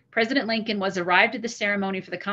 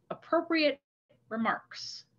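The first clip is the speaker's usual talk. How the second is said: ironic air quotes; 5 semitones higher, 5 dB quieter, slower than usual. Retold or imitated. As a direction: pitch jumps up on "appropriate", then "remarks" drops soft.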